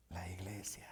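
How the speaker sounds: sudden hushed whisper; whispered punchline. Acted. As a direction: drop it to a breath for weight. Drops to a whisper.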